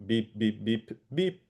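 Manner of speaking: flat, even beeps imitating tones; a run of identical beeps. Shown as a demonstration. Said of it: "sorry, I will do it".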